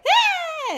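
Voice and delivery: high shrieking yelp; startled scream. Acted. Skater boy screams rolling past the group. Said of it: "he comes back the other way".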